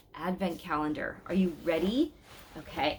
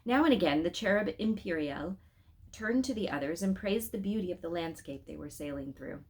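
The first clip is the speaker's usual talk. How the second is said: ordinary delivery, nothing marked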